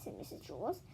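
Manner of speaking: ordinary delivery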